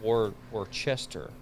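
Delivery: Worcester said slowly as "wor-CHESS-ter"; sounded-out place name. Shown as a demonstration. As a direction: feel out Worcester with a CH, "war-chester". Still fumbling the place name.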